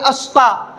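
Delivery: अस्का with a heavy, rounded emphatic S; मोटी आवाज. Shown as a demonstration. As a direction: say अस्का full-mouthed, towards "aw". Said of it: "मोटी आवाज में". (अस्का को पुर, मोटी आवाज़ में)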